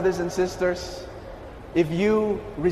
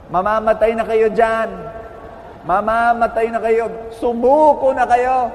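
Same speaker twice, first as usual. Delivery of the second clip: loud, raised retold shouts; shouting; 4 dB louder than usual. Frogs at the top shouting down to the two trapped frogs, shouting. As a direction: call out discouragement, imitating a shouting crowd. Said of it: "they started shouting to the two frogs".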